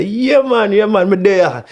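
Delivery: high, easy Jamaican agreement; my reply. Retold or imitated. He answers the Rasta man, high and easy. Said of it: "I say".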